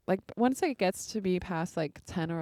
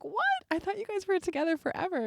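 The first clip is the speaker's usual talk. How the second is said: high squeaky retold What, staying high; playing the stunned stranger, shooting up in pitch; jaw-drop surprise, mimicked high; shocked disbelief; 12 semitones higher than usual. Stranger learns it was their first kiss.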